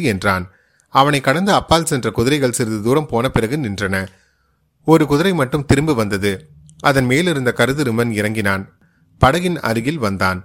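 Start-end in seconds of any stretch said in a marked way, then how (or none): none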